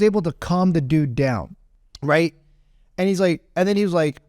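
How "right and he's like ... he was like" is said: usual speaking voice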